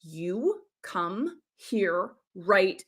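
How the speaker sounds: very slow and soft; slow intentional command. Shown as a demonstration. Teacher demoing a deliberate slow call. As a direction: measure out each word. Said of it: "Slow might be intentional".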